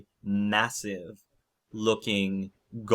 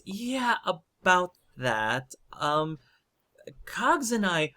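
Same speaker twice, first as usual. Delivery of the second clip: high, hesitant character voice; Dagna, explaining; 10 semitones higher than usual. Acted. Dagna pitching her invention to the queen, high and earnest. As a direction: pitch it up, nervously making a case.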